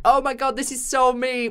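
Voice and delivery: high, loud gushing fan voice; mock a thrilled Disney adult. Imitating gushing fans who love her video. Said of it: "I got all the Disney adults like".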